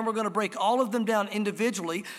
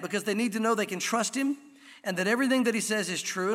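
ordinary delivery

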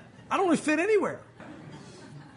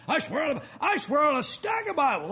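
mock solemn oath; 5 semitones higher than usual. Mimicking people who swear when they lie, hammed-up oath. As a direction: overdo it like a liar swearing.

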